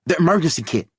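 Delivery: tense strained voice; tight voice. A narrator's reading. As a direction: keep the voice tight. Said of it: "voice tight".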